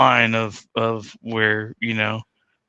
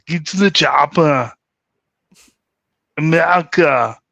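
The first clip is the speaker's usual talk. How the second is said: rough, gravelled Austrian bark; gravelly Arnold impression; 4 semitones higher, 3 dB louder than usual. Shown as a demonstration. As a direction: put the gravel in it like the action star. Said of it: "You gotta have the gravel in there".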